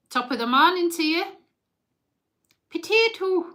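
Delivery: put-on shaky grasp of an Irish accent. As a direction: have a cheeky go at an Irish accent. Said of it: "It's bloody Irish".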